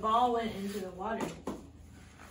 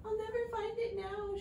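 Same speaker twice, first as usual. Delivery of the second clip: high, tearful princess lament; weeping princess; 12 semitones higher than usual. A narrator's reading. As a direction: wail it like a girl who lost her treasure. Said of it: "she wept".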